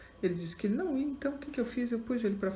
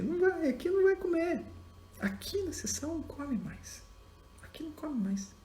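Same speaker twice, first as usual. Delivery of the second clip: teacher refusing firmly; 8 semitones higher than usual. Retold or imitated. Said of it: "Eu falei".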